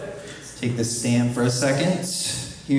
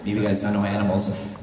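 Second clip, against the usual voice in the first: usual speaking voice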